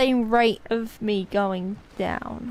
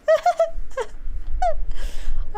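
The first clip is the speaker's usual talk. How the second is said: high wheezing laugh. Real, not acted. After ranting meltdown.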